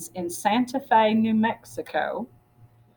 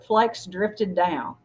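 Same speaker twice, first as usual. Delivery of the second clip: plain reading voice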